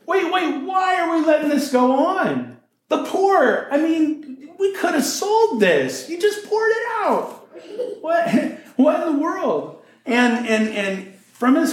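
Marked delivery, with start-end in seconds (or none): 0.0-9.8 much higher, indignant protest with a laugh breaking in; high-pitched scandalized cry of why are we wasting this; mock outraged yell. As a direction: play Judas squawking in outrage. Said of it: "he just kinda yells out".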